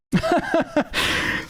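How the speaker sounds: high, breathy burst of laughter; laughing in disbelief; real laugh. Real, not acted. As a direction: break into helpless high laughter. Laughs at cancelled contracts scheme.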